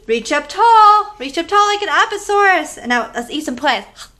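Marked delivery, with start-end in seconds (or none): 0.1-2.8 reach the voice way up; big high reaching call; high loud stretch cue. Shown as a demonstration. Leading kids' movement game.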